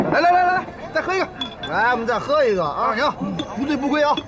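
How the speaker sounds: high, hearty call to drink up; rally the table for another round; rowdy toast. Acted. Rallying friends at dinner to drink another round, rowdy.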